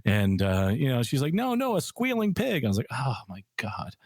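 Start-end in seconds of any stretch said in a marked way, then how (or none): 1.3-2.6 high-pitched imitation of her insisting; insistent correction. Retold flight attendant insisting on meaning. 2.9-3.9 slow, soft retold dismay; mortified realization.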